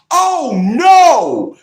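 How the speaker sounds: very high, loud theatrical dismay; mock-alarmed attorney. Attorney hearing a client's accusation, loud mock alarm. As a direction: wail it big in fake horror. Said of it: "Her attorney is gonna say".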